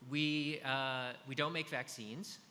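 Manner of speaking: ordinary delivery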